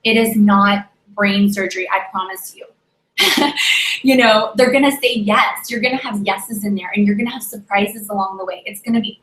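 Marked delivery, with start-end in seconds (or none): 3.2-4.0 breathy laugh. Real, not acted. Laughs after her own joke.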